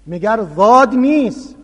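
«ضاد» said loudly as a proper zad, pressed as a question; loud insistent question. Retold or imitated. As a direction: push back hard: isn't this «ضاد»?. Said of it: "گفتم". (پرسش بلند و مصرانه، «ضاد» را درست و محکم تلفظ کن)

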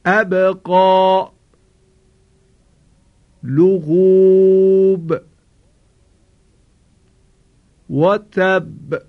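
slow tajwid, the ب of لغوب released with a bounce; recitation with qalqala. Shown as a demonstration. Teacher models the ba examples for the class. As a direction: chant slowly, echoing each ba.

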